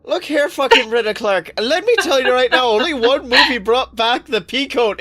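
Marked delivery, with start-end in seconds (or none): none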